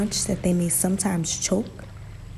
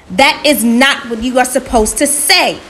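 loud, raised-pitch furious scolding; heated shout, voice pushed up; angry shout; 5 semitones higher, 12 dB louder than usual. A narrator's reading. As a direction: yell it in exasperation. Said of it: "Anansi shouted even more angrily".

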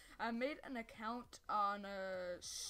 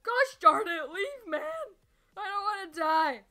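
loud, high desperate yelling; panicked yelling; 8 semitones higher, 13 dB louder, slower than usual. Real, not acted. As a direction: scream at them to leave.

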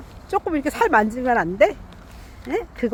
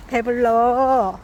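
drawn out; much slower than usual. Real, not acted. Talking to fed cat.

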